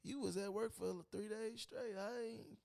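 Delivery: put-on hushed, gentle offer to his girlfriend; almost whispered, like in bed at night; soft quiet voice to babe. Excuse to girlfriend retold.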